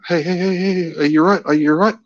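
high, anxious checking; worried. Retold or imitated. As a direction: shake her awake. Shaking her out of trance.

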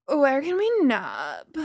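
put-on slow slurred drunk voice. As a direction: slur it like she's had too many. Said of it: "a drunken voice called".

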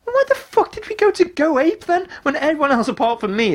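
high mock-indignant outburst; mock outrage at the group; exasperated outburst. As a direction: throw it out in disbelief. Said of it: "I'm like".

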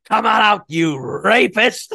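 booming wrestling promoter yell; Vince McMahon shout. Retold or imitated. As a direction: bellow it like a wrestling boss. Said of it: "that brilliant line from Vince McMahon".

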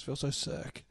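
whispered pitiful fake-sick line; mock sick whisper. Mimicking the apprentice's sick excuse, a mock whisper. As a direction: breathe it out like a faker.

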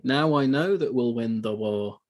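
slow, stilted film-line imitation; drawn out and stiff, mocking the acting; mock wooden delivery. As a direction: recite it woodenly, like a bad actor. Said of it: "he says really woodenly".

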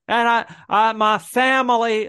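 put-on slow Southern accent, drawn-out "family". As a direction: imitate a coach's Southern accent, stretched and slow. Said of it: "he breaks into full Southern accent".

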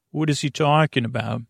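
ordinary talk, nothing marked

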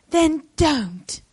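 light attempt at a Welsh lilt; Welsh accent. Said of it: "I'm not good at a Welsh accent".

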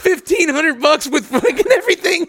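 high, half-crying, half-laughing, slowing at the end; laugh-cry through the panic of being scammed; laughing in disbelief. Real, not acted. Telling cohost about paying a stranger, laughing and crying at once.

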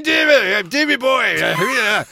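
hammed-up Scottish heckler voice, fast at the end; rowdy Scottish accent. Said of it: "they were Scottish".

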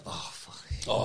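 low, hushed curse under the breath; imitated exasperation. Caught dog owner realises he must respond, quietly exasperated. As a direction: softly voice the dog owner's dismay. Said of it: "han laver det blik der".

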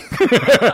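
loud, high burst of laughter; real delighted laugh. Real, not acted. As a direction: crack up at your own punchline. Laughs at his own punchline.

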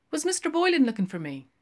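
high, fast eager question; eager man voice. Acted. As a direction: ask it quickly and brightly.

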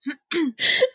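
high breathless giggle; wheezy laugh. Real, not acted. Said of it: "I'm so wheezy".

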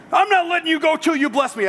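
high, bold demand; cling on and demand it like a hero; heroic defiance. Retold or imitated. Imitating how sermons voice Jacob, as a bold demand. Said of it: "that's how I've heard the sermons".

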